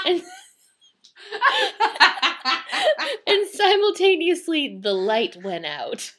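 laughing through the words; can't stop laughing. Real, not acted. Narrator cracking up laughing.

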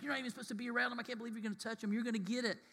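high, hushed alarm; aghast onlookers. Acted. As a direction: warn under your voice, mock-horrified. Onlookers voiced, aghast at touching lepers.

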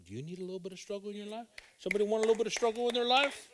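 high, fast, building to a shout; rising call to the crowd. Acted. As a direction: fire up the congregation. Preacher calls out to congregation.

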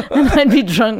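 normal talking voice

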